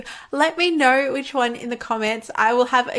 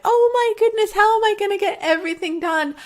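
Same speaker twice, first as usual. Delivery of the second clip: overwhelmed panic; 10 semitones higher than usual. Retold or imitated. Acting out Lady Overwhelm's panic.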